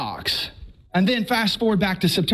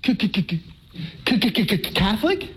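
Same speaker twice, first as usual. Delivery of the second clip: stammering shock. Retold or imitated. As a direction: choke on the word in disbelief. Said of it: "And I went".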